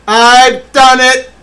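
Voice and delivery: high, loud, drawn-out triumph; ecstatic slow cry. Real, not acted. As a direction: stretch it out in ecstasy. Drawn out shout.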